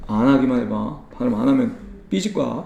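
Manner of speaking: 낮고 작게 투덜대듯 삐진 척; mock sulky threat, very soft and low. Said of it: "삐지는 feel이야". (삐진 척 낮은 목소리로)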